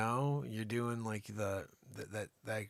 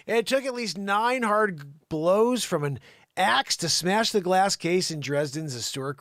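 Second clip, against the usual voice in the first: punchy newsreader, louder at sentence starts; news read; 6 semitones higher, 9 dB louder than usual. Acted. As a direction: tell the crime story like a TV report. Reads a news story aloud.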